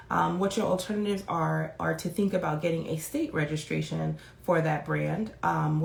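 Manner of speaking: ordinary delivery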